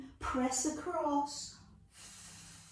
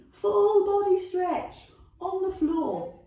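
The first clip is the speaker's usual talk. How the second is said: full body stretch, pitched up and louder, with gusto; louder excited repeat; 7 semitones higher, 5 dB louder than usual. Shown as a demonstration. Fitness teacher cheering on her class, louder and higher.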